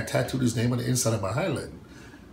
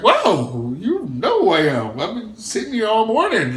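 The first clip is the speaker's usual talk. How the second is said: much higher, louder, laughing sarcastic wow; high, loud ironic amazement, laughing; laughing mock surprise; 10 semitones higher, 8 dB louder than usual. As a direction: laugh while teasing the rival. Laughing retelling of his reply.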